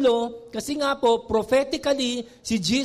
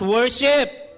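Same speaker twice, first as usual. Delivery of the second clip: loud worship; 3 semitones higher, 5 dB louder than usual. Shown as a demonstration. Preacher calls out worship! loudly to the church. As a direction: proclaim worship! to the congregation. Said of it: "Pag sabi nga po na malakas".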